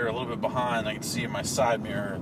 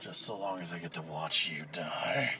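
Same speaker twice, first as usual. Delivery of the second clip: menacing; 5 semitones lower, 7 dB quieter than usual. Acted. Menacing aside after rant.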